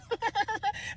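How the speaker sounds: high imitated rival's laugh; acted taunting laughter; mocking laugh. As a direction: put on her high-pitched cackle. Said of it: "She's laughing".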